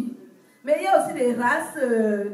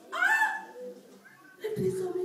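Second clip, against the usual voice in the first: normal talking voice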